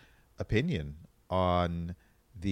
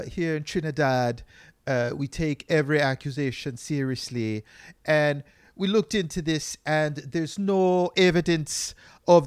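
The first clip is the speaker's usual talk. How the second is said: mock official in a Trinidadian accent, higher and louder; irritated Trinidadian accent; 7 semitones higher, 5 dB louder than usual. Medical council at a press conference, irritated Trinidadian accent. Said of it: "sounded like they were pissed".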